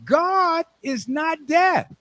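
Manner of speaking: high, slow scolding big-brother voice; older brother. Acted. Older brother scolding little kid at prayers, high and slow. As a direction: play the exasperated older brother, drawn out. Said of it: "his older brother said".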